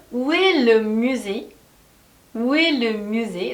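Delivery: very slow, word by word; slow drill. Shown as a demonstration. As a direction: model it slowly for repeating. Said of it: "다시 한번 따라해 볼게요". (따라 하도록 아주 천천히)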